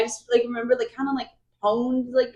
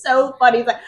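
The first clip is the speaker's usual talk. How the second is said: usual speaking voice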